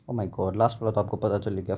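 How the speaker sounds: ordinary delivery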